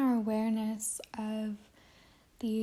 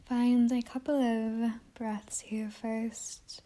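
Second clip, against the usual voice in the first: guided meditation. Acted. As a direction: guide listeners inward, unhurried, letting each phrase settle.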